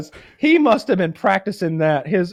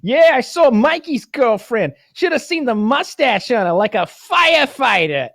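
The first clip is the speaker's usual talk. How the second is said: high, drawn-out teasing boast; ribbing gangster; 7 semitones higher, slower than usual. Retold or imitated. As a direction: stretch out the jibe for the whole crew. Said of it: "the one guy's like".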